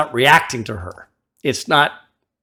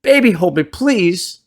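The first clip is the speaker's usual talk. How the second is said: begging; 5 semitones higher, 4 dB louder than usual. Retold or imitated. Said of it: "he's saying".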